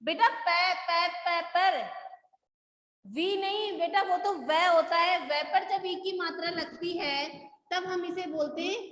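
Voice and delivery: ordinary talk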